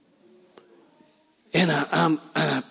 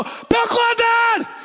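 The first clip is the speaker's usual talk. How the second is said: calling out to a celebrity at a distance; shouting a name; much slower than usual. Retold or imitated. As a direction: yell "Bill Clinton!" across the field, stretched out. Spots Clinton, calls out.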